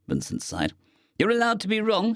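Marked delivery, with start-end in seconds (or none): 1.2-2.1 sniping. A narrator's reading. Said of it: "Stowaway sniped".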